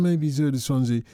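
ordinary talk, nothing marked

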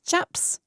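"Chaps" as a mimicked take on chips; mocking pronunciation. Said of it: "that's the way you say chips".

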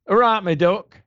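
East Midlands "All right, my duck" with a light rising lilt; an East Midlands greeting, lightly done; East Midlands accent. Retold or imitated. [name] tries the host's East Midlands greeting in her accent. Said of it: "the only thing I know to say in your accent is".

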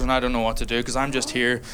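ordinary delivery

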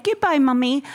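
put-on six-year-old English son. Six-year-old son saying goodbye to mum, imitated English child voice. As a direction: imitate a well-spoken small son. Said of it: "that's how he speaks".

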